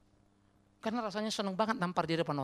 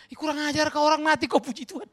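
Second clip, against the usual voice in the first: high, loud outraged rebuke; shocked, indignant outburst; indignant scolding; 11 semitones higher, 10 dB louder than usual. Acted. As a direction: scold the testifier at full voice. Scolding the testifier he mimicked.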